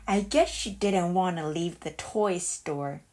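plain reading voice

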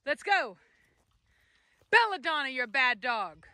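ordinary talk

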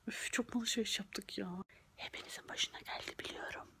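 hushed whispered complaint; whispering. Acted. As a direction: whisper it like a secret. (fısıltıyla)

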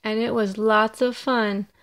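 plain reading voice

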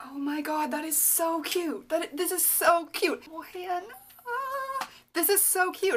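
high-pitched cooing over something cute; melting at how cute it is; gushing delight. Real, not acted. Gushing over cute gift packaging.

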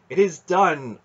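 high, loud ringing proclamation; soaring exultation. A narrator's reading. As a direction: declare it in exultant triumph. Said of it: "with a soaring exultation".

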